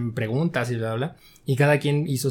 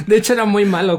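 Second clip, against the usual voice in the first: higher and louder, said through laughter; laughing; 6 semitones higher, 7 dB louder than usual. Real, not acted. Laughing as he admits to the interviewer he was bad. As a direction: confess it while still laughing.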